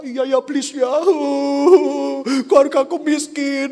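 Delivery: high, loud hammed-up wail; mock crying. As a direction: give in with exaggerated self-pitying sobs. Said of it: "kita nangis".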